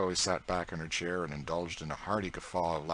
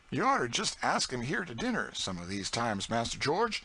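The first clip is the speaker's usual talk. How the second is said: Aunt Chloe's voice; 7 semitones higher than usual. A narrator's reading. Aunt Chloe boasting to young George, broad proud dialect. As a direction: boast with a scornful toss of the head.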